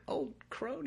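high, soft mock-affronted question; offended disbelief. Old woman reacting to the hero's insult, softly affronted. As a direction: echo the insult back, taken aback. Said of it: "And she was like".

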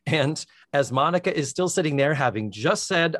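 ordinary talk

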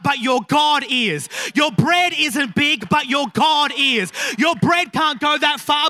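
slow, loud declarations building to a shout; impassioned shouted sermon climax; full-force preaching. Acted. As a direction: proclaim each phrase forcefully to a congregation.

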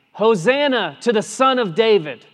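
Hosanna called out loud and high; shouted Hosanna. Acted. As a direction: cry it out like the children in the temple. Children shouting Hosanna in temple.

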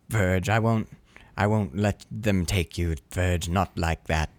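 understated, low and slow acted vow; a touch of sorrow, slow and subdued; grave husband. Voicing grieving husband at wife's bed.

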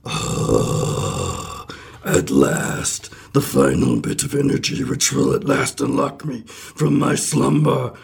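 breathy spectral creature, climbing at the end; low haunting voice. Acted. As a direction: ghostly voice like a Muppet, rising to free. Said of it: "the ghostly voice says".

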